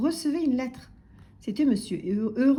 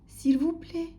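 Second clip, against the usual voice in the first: small, higher, timid voice; Monsieur Silence; 7 semitones higher, slower than usual. A narrator's reading. Timid customer to singing butcher, meek. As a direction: plead meekly for attention. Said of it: "murmurait Monsieur Silence".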